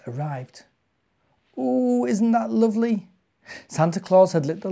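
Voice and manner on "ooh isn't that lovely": high, wondering ooh of delight; delighted gasp. A narrator's reading. Child seeing his Christmas presents, high and delighted. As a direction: marvel at the lit tree. Tim sees lit Christmas tree.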